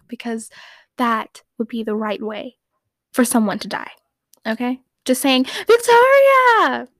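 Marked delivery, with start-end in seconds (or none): 5.4-6.9 high, very slow, loud drawn-out yell of the full name; mock Jeremy yell. Fan showing how Jeremy should have yelled the name. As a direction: stretch the full name out in a grand wail. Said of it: "what Jeremy should have said".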